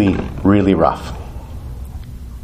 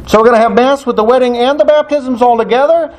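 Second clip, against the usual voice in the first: high-pitched, disbelieving question; incredulous; 10 semitones higher than usual. Retold or imitated. Priest hearing a wedding joins the baptisms, incredulous. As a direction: rattle it off as a stunned query.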